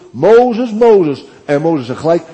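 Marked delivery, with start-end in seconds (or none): 0.1-1.2 loud, high calling of the name; loud divine call; God calling. Acted. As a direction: call him out like a voice from heaven. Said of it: "De Heere roept".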